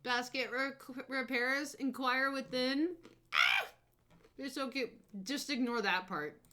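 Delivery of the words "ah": delighted squeal. Real, not acted. Delighted at the sign.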